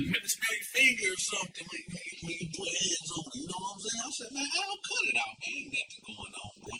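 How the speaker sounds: unvoiced secretive whisper. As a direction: whisper it so no one overhears. Whispered talk about the guard.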